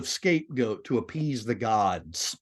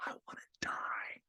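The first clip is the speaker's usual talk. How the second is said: breathy whisper of despair; 17 dB quieter than usual. Acted. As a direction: barely breathe the words out. Sibyl quote whispered.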